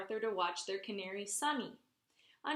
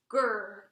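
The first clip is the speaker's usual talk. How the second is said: growl; 7 dB louder than usual. Acted. Dog growling at new sitter. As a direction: growl like a grumpy little dog. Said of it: "growled Perky".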